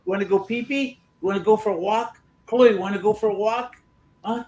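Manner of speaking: high, coaxing pet voice. Real, not acted. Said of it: "you'd fit right in at the animal shelter".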